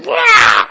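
high, very loud wail; meltdown wail. Retold or imitated. Husband melting down at his wife, screamed wail. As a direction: scream it out in a hammed-up tantrum. Acted meltdown on phone call.

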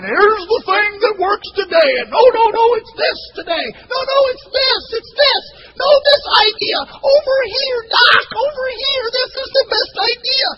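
high, very loud, frantic shouting over each other; clamoring voices. Retold or imitated. The preacher mocking rival church authors, high frantic shouting. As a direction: yell like a crowd of authors all pushing their idea.

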